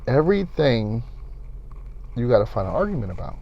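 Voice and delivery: softened, reasoning voice; quiet sit-down talk. Retold or imitated. Asking a girlfriend why she argues, quiet reasoning voice. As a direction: put the questions softly, without confrontation.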